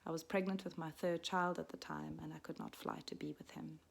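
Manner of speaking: ordinary delivery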